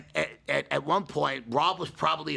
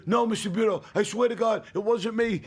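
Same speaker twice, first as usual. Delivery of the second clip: put-on high, louder whiny kid begging; pleading teen; 8 semitones higher, 4 dB louder than usual. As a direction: play the caught kid swearing innocence. Imitating teen friend denying guilt.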